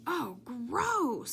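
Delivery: high disgusted sneer; disgusted groan. A narrator's reading. Jason disgusted by the noseless teacher, high. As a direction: recoil in disgust.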